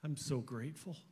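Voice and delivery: soft, halting prayer spoken to God; heartfelt prayer. Real, not acted. Pastor thanks God for his parents, in a soft, tender prayer. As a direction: pray quietly, as if alone with God. Speaker prays aloud after testimony.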